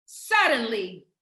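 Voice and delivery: drawn-out, falling "suddenly"; slow emphatic suddenly. Shown as a demonstration. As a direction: let "suddenly" land slow and low. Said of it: "I want you to write down the word suddenly".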